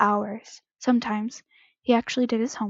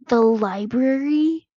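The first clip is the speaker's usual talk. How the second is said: slow, louder, exasperated question; 5 dB louder, slower than usual. A narrator's reading. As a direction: draw it out in disbelief, pitch climbing. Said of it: "sounding exasperated".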